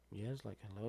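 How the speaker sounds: drowsy, just woken up; groggy answering the phone; sleepy voice. Retold or imitated. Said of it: "sleepy voice or whatever".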